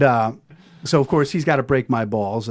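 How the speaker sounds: ordinary talk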